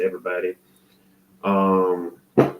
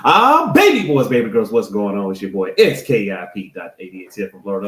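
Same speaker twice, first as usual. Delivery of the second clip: louder, hyped-up show greeting; loud and obnoxious; 2 semitones lower, 6 dB louder than usual. Real, not acted. Host dropping the act, greeting viewers loud and hyped. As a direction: blast the welcome like a hype man. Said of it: "the loud and obnoxious Skip dot eighty-eight".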